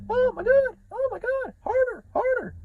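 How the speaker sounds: very high falsetto moans, hammed-up. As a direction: camp it up as a moaning woman in a squeaky falsetto. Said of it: "and she's moaning".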